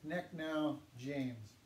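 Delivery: Nek now James, very slow and clear; teacher demonstrating, slowly; slow model phrase. Shown as a demonstration. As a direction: spell it out slowly for the class. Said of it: "my name is".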